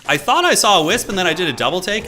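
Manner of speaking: high, loud excited retelling. Real, not acted. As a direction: rush out the surprise.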